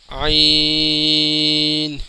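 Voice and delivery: عین from the throat, very long, closing on n; final n sounded. Shown as a demonstration. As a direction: stretch عین slowly into its n. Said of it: "آخر میں نون کی آواز نکلے گی".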